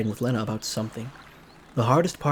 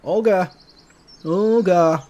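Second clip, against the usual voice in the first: loud call, higher; 6 semitones higher, 7 dB louder, slower than usual. Acted. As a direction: shout across the camp for her. Calling for the leader.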